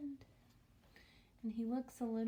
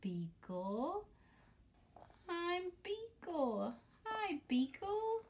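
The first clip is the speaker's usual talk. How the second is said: high, slow sweet little voice; high slow voice for Beagle introducing himself; cute character voice; 8 semitones higher, slower than usual. Acted. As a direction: say it like a shy cute pet. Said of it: "This one over here says".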